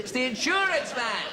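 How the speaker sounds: high, exasperated acted shout; frustrated shout. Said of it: "until frustration and excitement".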